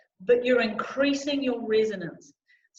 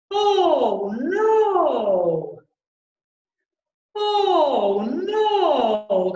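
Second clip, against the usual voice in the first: loud, rounded "Oh, no" in long falling swoops; wide throat; 7 dB louder, much slower than usual. Shown as a demonstration. Coach showing choir the widened throat space, wide and loud. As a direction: open the throat wide and let it ring. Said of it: "widening that throat space".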